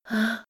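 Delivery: short high "ah" before fainting; high, faint gasp. A narrator's reading. Girl seized by the hangman, high faint cry. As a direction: let out a small high cry.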